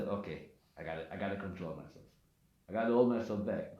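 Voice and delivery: low, quick, soft aside; talking yourself down under your breath; hushed inner voice, low and hurried; quoted self-restraint. Retold or imitated.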